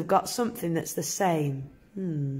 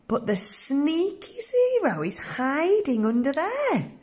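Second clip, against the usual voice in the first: sneaky secret; 6 semitones higher than usual. Acted. Teacher building mystery for children.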